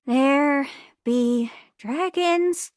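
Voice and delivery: very slow, portentous delivery; drawn-out ominous warning. Acted. Warning of dragons, slow and ominous. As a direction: stretch each word out for effect. Scripted line, spooky old map warning.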